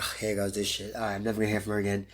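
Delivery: soft, fast, defeated aside to himself; resigned mutter. Retold or imitated. Fighter expecting the producer never calls back, resigned quick mutter. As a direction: mutter it quickly like you've given up already.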